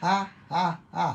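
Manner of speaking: three high, separate ha's; deliberate laugh. Shown as a demonstration. Preacher showing the Lord laughing, high deliberate laugh. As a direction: voice a laugh on purpose. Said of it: "Si Lord tumatawa".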